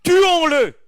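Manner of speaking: high, loud cry of a plotting mob; mock crowd cry. Preacher voicing the mob, high and loud. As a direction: call for the killing.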